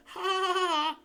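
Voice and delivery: high, loud gleeful cackle; mocking cackle. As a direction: cackle in triumph. Peeves jeering laugh.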